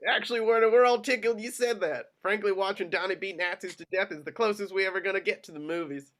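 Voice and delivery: high, loud and amused; giggle in voice. Acted. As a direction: say it as if barely holding in a laugh. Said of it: "with a giggle in his voice".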